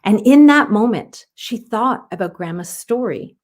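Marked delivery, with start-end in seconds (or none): none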